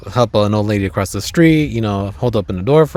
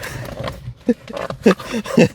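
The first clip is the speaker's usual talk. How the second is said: high wheezy laugh; 12 semitones higher than usual. Real, not acted. Laughing at puppy destroying plant.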